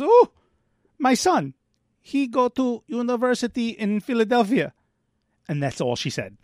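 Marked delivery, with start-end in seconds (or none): 0.0-4.7 put-on higher, light Russian accent; a proud mother in a light Russian accent. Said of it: "So the Russian woman goes".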